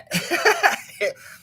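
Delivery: high bursting laugh. Real, not acted. Vlogger laughing about the contradiction, high laugh. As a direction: break into laughter.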